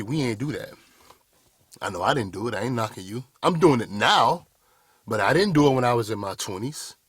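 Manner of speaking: usual speaking voice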